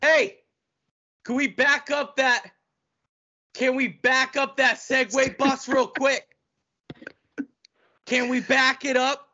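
high-pitched, teasing call to halt; mock protest. Asking brother to back up the segue bus, high mock protest. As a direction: pipe up high in playful protest.

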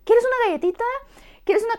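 put-on high, sing-song coaxing of a child; talking to a parrot. An adult bribing a crying child with cookies, high and sing-song. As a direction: coax like offering a parrot a treat. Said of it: "como si fueras un lorito".

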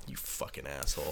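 grumbling at the departed cop, under the breath; muttered insult. Retold or imitated. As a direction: mutter it so nobody hears. Muttered insult after cop leaves.

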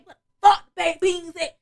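slow, loud, furious; drunk rage. Retold or imitated. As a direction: demand the beans. Drunk rage about baked beans.

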